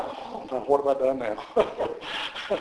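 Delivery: hushed, slow mock dread. Pastor to himself when a member wants to see him, hushed mock dread. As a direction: mutter it low and slow, as if bracing for trouble.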